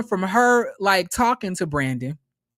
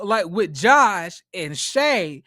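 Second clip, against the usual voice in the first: usual speaking voice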